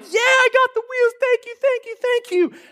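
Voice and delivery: put-on high-pitched kid's cheer on getting a present; over the moon. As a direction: re-enact a thrilled child's squeal of thanks. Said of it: "I was over the moon".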